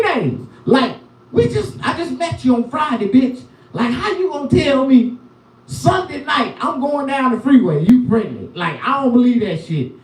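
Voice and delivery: high, disbelieving retold outburst; incredulous rant. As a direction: scoff at the news in mock outrage. Retelling reaction to pregnancy news.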